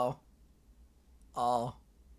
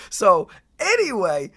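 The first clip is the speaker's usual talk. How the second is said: ordinary talk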